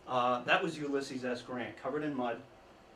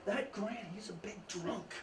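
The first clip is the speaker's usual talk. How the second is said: hushed, higher sneering tattle; jealous gossip; 6 semitones higher, 9 dB quieter than usual. Retold or imitated. Jealous generals tattling to Lincoln, hushed and sneering. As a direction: badmouth Grant softly, behind his back. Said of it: "would approach Lincoln and say".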